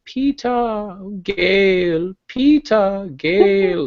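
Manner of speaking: high, swooning sing-song of the two names; mocking swoony heroine. Mocking the heroine's endless inner monologue, high and swooning. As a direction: sigh the names like a lovesick teen. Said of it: "There was a lot of".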